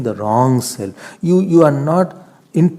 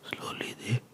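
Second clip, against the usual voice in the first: whisper; 10 semitones lower, 10 dB quieter, slower than usual. Shown as a demonstration. Fading into weakness.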